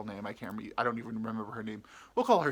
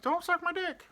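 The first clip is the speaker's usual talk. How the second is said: high-pitched mock panic; alarmed plea; 3 dB louder than usual. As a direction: squeal it in comic protest. Acted alarmed plea to girl.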